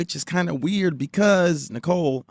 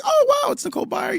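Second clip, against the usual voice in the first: mock excitement; faster than usual. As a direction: act out spotting her on the app. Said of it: "and so I'm like".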